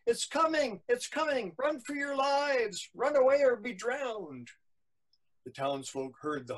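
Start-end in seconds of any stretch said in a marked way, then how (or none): 0.0-4.5 put-on storyteller's hollering boys; yelling alarm. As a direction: call it through the town, pitched way up. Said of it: "yelling up Main Street and down".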